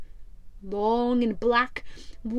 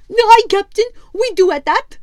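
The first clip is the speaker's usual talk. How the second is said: much higher, louder sidekick voice agreeing; a squeaky, eager yes-man; squat sailor; 9 semitones higher, 11 dB louder than usual. Acted. Squat sailor agrees with his captain, high and loud. First mate answers.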